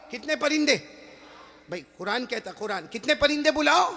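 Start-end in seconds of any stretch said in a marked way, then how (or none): none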